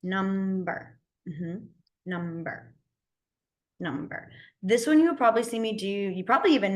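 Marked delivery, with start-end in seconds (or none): 0.0-4.3 slow drilled repetition. Shown as a demonstration. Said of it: "you're gonna go number".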